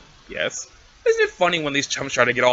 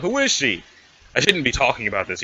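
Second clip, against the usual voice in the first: ordinary talk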